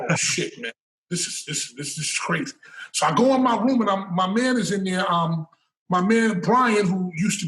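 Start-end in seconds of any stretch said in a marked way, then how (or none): 0.1-2.5 hushed, breathy disbelief; a laughing hushed aside; shocked whisper. Retold or imitated. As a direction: whisper it like you can't believe it.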